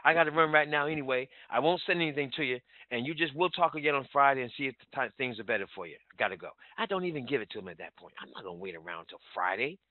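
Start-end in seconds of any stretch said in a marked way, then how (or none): 0.0-6.5 quick and louder, unbothered brush-off; no problem, gotta run; breezy urgency. Shown as a demonstration. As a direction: rush on like you've got places to be. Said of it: "That's how I sound".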